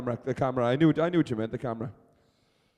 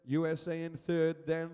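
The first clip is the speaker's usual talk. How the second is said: sustained, raised play-by-play voice; live race commentary; 3 semitones higher, 7 dB quieter than usual. Real, not acted. Commentator calling the relay race for spectators, live play-by-play. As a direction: call the race as it unfolds.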